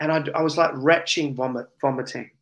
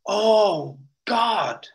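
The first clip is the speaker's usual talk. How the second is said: awed gasp; 6 semitones higher than usual. Retold or imitated. Overwhelmed in God's presence.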